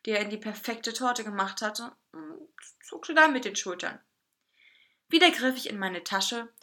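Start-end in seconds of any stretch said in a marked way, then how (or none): none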